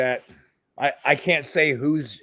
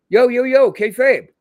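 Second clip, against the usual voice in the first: loud, higher hushing call of kayfabe; urgent warning; 9 semitones higher, 8 dB louder than usual. Retold or imitated. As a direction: cut him off loudly to protect the secret. Warning idiot to keep kayfabe.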